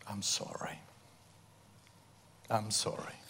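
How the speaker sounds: hushed, broken "I'm sorry"; whispered apology. Retold or imitated. Father whispering sorry to his ex-wife and children, hushed. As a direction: barely breathe the apology.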